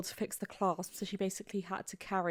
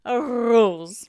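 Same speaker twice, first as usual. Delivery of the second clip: loud Scottish "rose" with a heavily rolled R; exaggerated Scottish accent; 3 semitones higher, 11 dB louder than usual. Shown as a demonstration. Having another go at the hard word, exaggerated Scottish accent. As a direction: belt out "rose" in broad Scottish. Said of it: "That's quite a hard word to say in Scotland".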